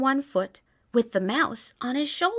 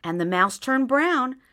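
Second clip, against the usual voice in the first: ordinary delivery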